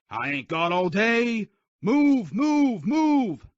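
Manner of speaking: roaring move move move; drill sergeant yelling. Acted. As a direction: scream orders at recruits. Drill sergeant wakes recruits.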